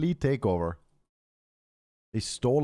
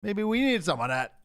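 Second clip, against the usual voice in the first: higher, scheming aside; sly plotting in a raised voice; mock greedy superpower; 10 semitones higher than usual. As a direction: muse on grabbing the oil in a put-on high voice. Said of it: "might at some point be like".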